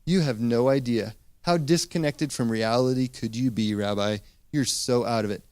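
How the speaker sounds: a hint of cynical mockery, voice dropped low; mild cynical scoff. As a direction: crowd mildly scoffing at Jesus, quietly. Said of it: "That sort of cynical".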